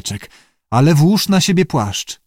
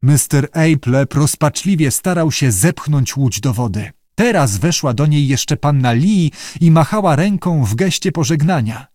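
plain reading voice

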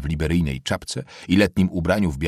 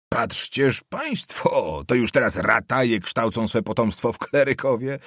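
mocking amazement; 4 semitones higher than usual. Nobleman marvelling at farmhands schooling their children, mocking. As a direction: stretch the first words in mock wonder.

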